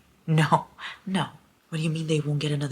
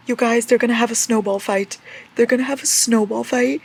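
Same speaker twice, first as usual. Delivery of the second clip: high, loud, thrilled telling of the news; excited gushing; 8 semitones higher, 8 dB louder than usual. Real, not acted. Reader gushes to viewers about the book, thrilled. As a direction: spill the news with glee.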